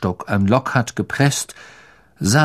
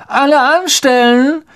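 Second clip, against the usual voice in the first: high, loud taunting shout to the crowd; booming call; 14 semitones higher, 5 dB louder than usual. Acted. Malfoy calls the crowd, loud mock announcement. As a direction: boom it out to the whole yard. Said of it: "dröhnte Malfoy in die Menge".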